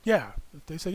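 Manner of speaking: ordinary talk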